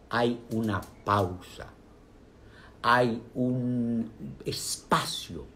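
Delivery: slow, drawn-out pause. Shown as a demonstration. Demonstrating the pause.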